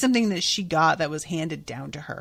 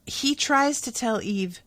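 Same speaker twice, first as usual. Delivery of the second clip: ordinary talk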